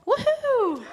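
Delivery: very high celebratory woohoo; excited cheer. Real, not acted. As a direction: whoop high for the winner. Cheering a raffle winner.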